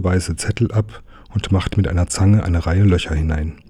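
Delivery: ordinary delivery, nothing marked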